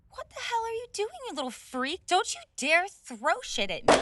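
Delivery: high, loud furious shriek; shrieking anger. Acted. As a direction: scream at the attacker. Something thrown at him.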